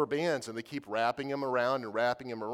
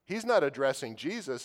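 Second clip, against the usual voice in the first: normal talking voice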